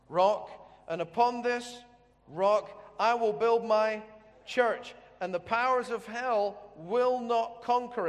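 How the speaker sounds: slow, loud solemn declaration; proclaiming. Acted. Voicing Jesus to Peter, a slow loud solemn proclamation. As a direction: proclaim each word with weight.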